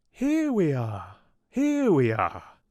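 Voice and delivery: a light, high little chorus answering; chorus of flowers. A narrator's reading. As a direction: call back like a choir of small flowers. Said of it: "came the reply in words and in song".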